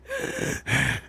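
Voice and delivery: worn-out heavy gasps; tired panting. Acted. Asshole tired after beating the heart, panting. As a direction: pant as if spent from a fight. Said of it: "so now the asshole's tired".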